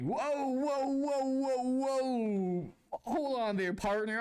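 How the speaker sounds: high, drawn-out string of whoas; high-pitched retold protest; mock alarm. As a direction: fend them off in hammed-up alarm. Retelling his protest at flirting.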